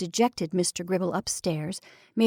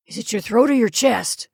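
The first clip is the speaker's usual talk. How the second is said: gruff; 6 dB louder than usual. Acted. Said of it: "he inquired gruffly".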